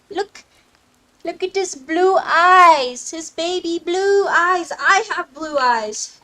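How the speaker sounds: loud, slow, drawn-out gushing; excited gushing; gushing delight. Real, not acted. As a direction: swoon over the pretty eyes at full voice. Gushing over unicorn's eyes.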